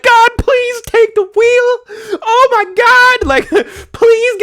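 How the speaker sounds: high, slow, wailing fake sob; mock crying plea. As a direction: wail to God in hammed-up tears. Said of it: "You're like".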